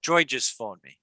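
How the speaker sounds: ordinary delivery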